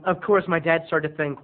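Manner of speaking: ordinary delivery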